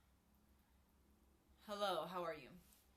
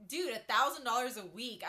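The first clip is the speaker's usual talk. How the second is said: louder, punchy burst of disbelief; excited exclaim; 3 semitones higher, 10 dB louder than usual. Real, not acted. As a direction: throw out the number like it's obvious. Exclaiming at the offer.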